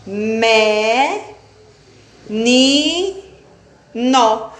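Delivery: "Pé, te, ca" sílabas destacadas, com pausas; silabando. Shown as a demonstration. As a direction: bata cada sílaba de peteca. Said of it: "Viram só?". (separando sílabas para a turma)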